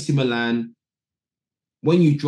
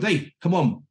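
higher, cheerful call to join; Italian stars inviting them in; 6 semitones higher than usual. Retold or imitated. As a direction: teammates rallying the young players. Teammates calling to join in.

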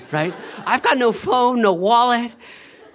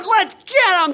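put-on higher, loud rallying shout of a kid; kid battle cry; 11 semitones higher, 5 dB louder than usual. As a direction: charge like a little pirate. Kids jumping off tractor.

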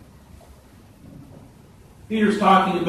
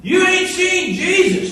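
cocky rookie sneer; 5 dB louder than usual. Retold or imitated. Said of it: "And he says".

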